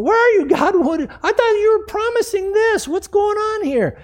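very high panicked questioning; acted frantic protest. Believer questioning God in the storm, frantic. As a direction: demand answers in acted alarm. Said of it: "They start to freak out".

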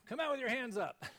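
God imagined as an arresting officer; police officer. Retold or imitated. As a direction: bark it like a cop at an arrest. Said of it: "as an arresting police officer".

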